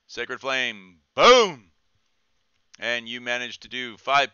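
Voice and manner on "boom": excited boom. Real, not acted. Spell cast exclamation.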